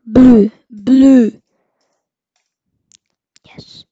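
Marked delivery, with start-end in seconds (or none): 0.1-1.3 loud excited call-out. Real, not acted. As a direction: shout the colour in delight. Excited calling out loot.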